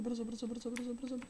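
high, hushed rapid urging; urgent hurry. Real, not acted. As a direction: press them to hurry, tense.

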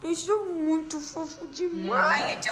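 slow, whispery squeak climbing very high; cutesy pet voice. Pretend. Owner speaking for the dog, cutesy squeaky voice. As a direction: voice a cute little dog, drawn out.

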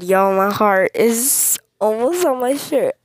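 ordinary talk

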